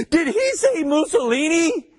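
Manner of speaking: gasp, then squeal it in feigned disbelief; mock shock. Host feigning shock at Mussolini's name, gasping mock horror.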